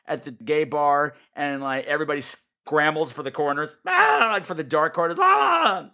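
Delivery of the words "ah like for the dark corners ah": loud, much higher hammed-up shriek; mock shriek. Mocking clubgoers fleeing the lights, hammed-up shriek. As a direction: scream like the bar lights just came on. Mocking bar crowd scrambling.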